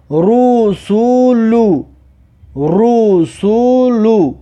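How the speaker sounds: slow syllables with held vowels; drawn-out model reading. Shown as a demonstration. Teacher models a word for learners, slow and drawn out. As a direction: stretch "رسولو" syllable by syllable. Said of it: "در بینش حرف سین است".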